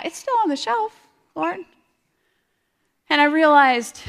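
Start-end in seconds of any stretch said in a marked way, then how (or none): 0.0-1.6 much higher, mock-sheepish confession; sheepish admission. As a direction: admit it to God with a guilty lilt. Confessing neglected Bible to God.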